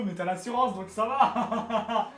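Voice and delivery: laughing. Real, not acted. Joking threat while laughing.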